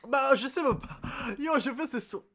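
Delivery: high, loud flustered exclamation; real outburst before a laugh. Real, not acted. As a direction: burst out, half laughing. Loud outburst reacting to the scene.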